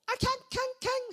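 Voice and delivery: very high, squealed animal yelping; shrieky mock fox cries; imitated fox yelps. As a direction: yelp like a scalded fox. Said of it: "여우가 막 뜨거워가지고".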